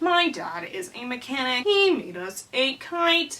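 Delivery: stilted robot-like character voice; robotic. Acted. Luke the storybook brother stating facts, robotic. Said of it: "like, robotic".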